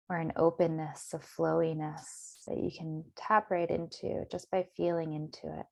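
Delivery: calm, measured meditation voice with long pauses; guided meditation. Acted. Meditation teacher settling listeners into their bodies, guided meditation. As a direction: guide them gently inward, unhurried.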